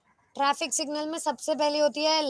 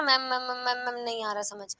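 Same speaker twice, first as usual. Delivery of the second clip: mock a student mumbling a complaint; mimicked confused student; 2 semitones lower, 6 dB quieter than usual. Teacher mimicking students who don't understand, a soft mumbled drone. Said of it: "नहीं और आ रहा है में कुछ खा गए हो".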